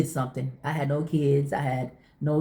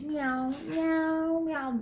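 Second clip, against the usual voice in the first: high whining meows; whiny cat meow; 12 semitones higher, 6 dB quieter, much slower than usual. Retold or imitated. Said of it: "like she's whining about something".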